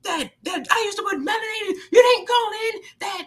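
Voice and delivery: high-pitched shrieky parody rant; mock ranting host. Mocking the radio host ranting at a caller, high mock shout. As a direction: squeal out her rant in a shrill mock voice. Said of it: "she just went on some rants".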